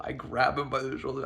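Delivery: ordinary talk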